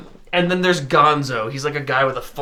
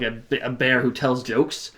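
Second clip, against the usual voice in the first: ordinary talk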